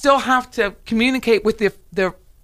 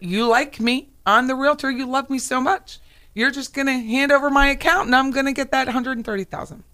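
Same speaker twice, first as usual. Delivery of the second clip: lightly voiced con man, higher; scammer's sweet talk; 6 semitones higher than usual. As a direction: coax like a scammer flattering a mark, pitch up.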